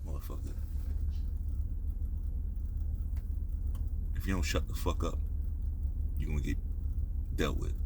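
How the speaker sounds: very low, hushed private warning; quiet threat. Acted. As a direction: threaten him softly so nobody else hears. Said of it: "When you gotta say some real shit".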